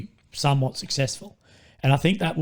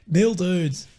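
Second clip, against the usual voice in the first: normal talking voice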